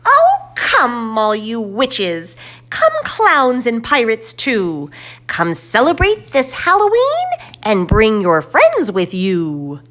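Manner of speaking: very high, loud squealing witch; shrill excited crone calling everyone in; witch's squeal. Acted. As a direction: squeal out the invitation with glee. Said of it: "we hear Miss Fiona squeal".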